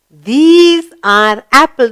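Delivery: slow, clear model reading; slow answer model. Shown as a demonstration. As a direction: give the answer slowly for the class. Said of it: "What are they?".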